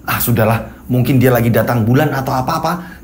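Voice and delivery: low, resigned thought; low shrug-off. A narrator's reading. Husband brushing off his wife's temper, low. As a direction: talk yourself out of worrying.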